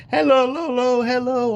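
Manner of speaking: high, singsong called-out hello; smiling greeting. Retold or imitated. Grandfather greeting granddaughters in the car, smiling high call. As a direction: call out brightly with a smile, pitch way up. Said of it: "And I smile and ask".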